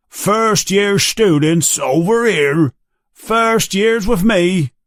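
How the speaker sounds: put-on gather the children with a friendly call. Hagrid calling students.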